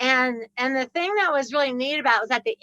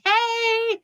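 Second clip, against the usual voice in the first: cheery hey; 9 semitones higher than usual. Retold or imitated. Waving back at her from car.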